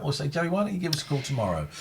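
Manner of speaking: ordinary delivery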